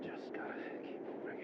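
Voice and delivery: hushed, breathy under the breath; whispering. Acted. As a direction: whisper it to yourself. Whispered game line.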